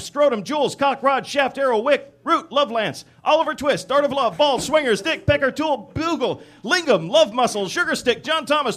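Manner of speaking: emphatic dictionary recital; rattled-off list. Shown as a demonstration. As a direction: read the list out item by item like a roll call.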